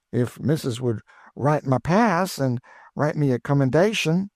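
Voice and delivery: put-on high, eager old cook's voice; high cheerful Chloe, teasing her missus; Aunt Chloe. A narrator's reading.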